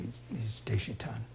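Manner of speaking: hushed, almost secret; whispered aside. Retold or imitated. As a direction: whisper the clan name under your breath. Naming his clan in hushed aside.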